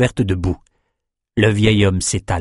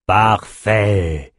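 drawn-out, relishing approval; jubilant. A narrator's reading. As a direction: savour it with glee. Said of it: "jubila Nathan".

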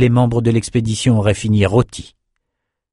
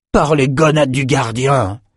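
soldier's cry; 5 semitones higher than usual. Acted. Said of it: "cria un des soldats".

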